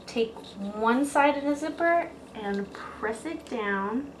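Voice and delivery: ordinary talk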